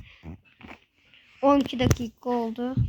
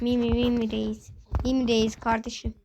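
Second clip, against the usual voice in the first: ordinary talk, nothing marked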